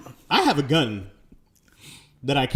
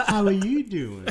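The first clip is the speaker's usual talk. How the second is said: laughing; 9 semitones higher, slower than usual. Retold or imitated. Laughing mock sweet.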